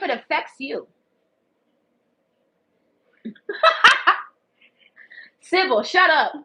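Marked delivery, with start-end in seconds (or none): 3.2-4.2 high loud cackle; burst of laughter. Real, not acted. At a viewer's comment, loud high laugh. 5.4-6.3 loud teasing scold, laughing off; playful shut up. Real, not acted. To Sybil in chat, loud playful scolding.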